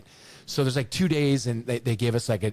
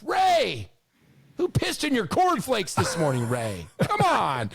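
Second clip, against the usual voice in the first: fed-up player retort; 11 semitones higher, slower than usual. Retold or imitated. Rock finally snapping back at coach Ray, high exasperated shout. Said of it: "practice just stops and goes".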